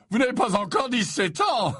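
high put-on professor voice, ending in a chuckle; jovial Slughorn. Slughorn teases underage pupils, jovial high voice and laugh.

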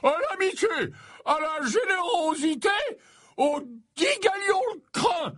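very high, whooping toast; exuberant, high-pitched cheer; drunken cry. A narrator's reading. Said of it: "au cri de".

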